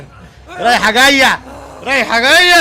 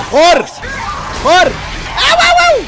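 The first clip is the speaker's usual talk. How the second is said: very high loud oh oh; jumpy alarm; high loud cries; startled yelps; 10 semitones higher, 6 dB louder than usual. Real, not acted.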